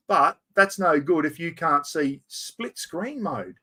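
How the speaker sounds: ordinary talk, nothing marked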